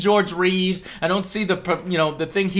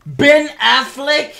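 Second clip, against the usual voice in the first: slow, rising incredulous question; can't believe the name; disbelief; 4 semitones higher, slower than usual. Real, not acted. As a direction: Ben Affleck? in stunned disbelief. Incredulous rant at casting news.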